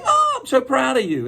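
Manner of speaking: high, gushing mock-mom voice; gushing proud mom. Mom praises child for cleaning, high acted gush. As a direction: gush with pride, pitch way up. Voicing proud mother's reply.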